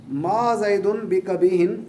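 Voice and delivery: slow Arabic example. Shown as a demonstration. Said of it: "example sentence read out".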